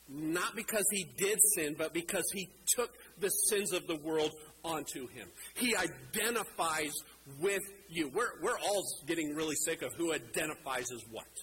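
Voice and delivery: usual speaking voice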